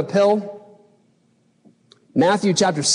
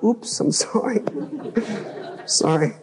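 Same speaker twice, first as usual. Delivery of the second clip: sheepish apology; 5 semitones higher, 6 dB quieter than usual. Retold or imitated. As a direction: sheepishly take it back to God. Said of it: "I was like".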